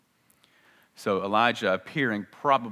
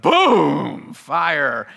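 loud boom; 3 semitones higher, 9 dB louder than usual. Acted. Fire falls from heaven.

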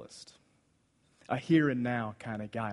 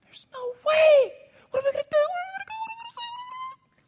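very high crying shout fading to a sob; frustrated wail; 4 dB louder, much faster than usual. Acted. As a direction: break down in despair. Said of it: "he's so frustrated".

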